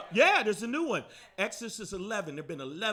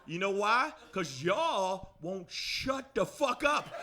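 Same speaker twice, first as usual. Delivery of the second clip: ordinary talk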